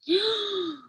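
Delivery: high startled gasp; sharp gasp. Acted. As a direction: gasp in shock as the baby is taken. Baby snatched by dragon.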